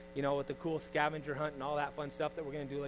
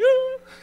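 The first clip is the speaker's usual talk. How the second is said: high burst of laughter; 14 dB louder than usual. Real, not acted. Laughs at own joke.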